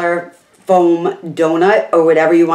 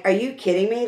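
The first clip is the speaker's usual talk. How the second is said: soft, strained, hoarse quote; voice giving out; 3 semitones higher, 8 dB quieter than usual. Retold or imitated. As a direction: say it as the voice cracks away. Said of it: "I'm losing my voice".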